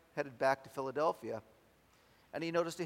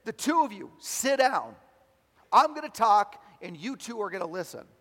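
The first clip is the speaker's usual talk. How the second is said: loud, raised commanding voice; great authority; 6 semitones higher, 9 dB louder than usual. Acted. Preacher voicing Tony ordering the couple to listen, great authority. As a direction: lay down firm orders, easing off at the end. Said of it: "Tony spoke with great authority".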